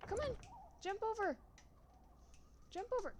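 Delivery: high-pitched pet-owner voice; high, sing-out commands to a pet; coaxing a dog. Real, not acted.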